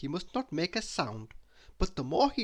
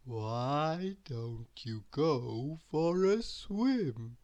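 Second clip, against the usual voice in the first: slow, drowsy lazy drawl; lazy Mister Lazy; much slower than usual. A narrator's reading. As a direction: yawn it out like a lazybones. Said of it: "suggested Mister Lazy".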